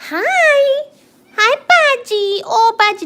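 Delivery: very high, bright coo at the cat; high cooing hello to a cat. Real, not acted. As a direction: sing a squeaky hello to the pet.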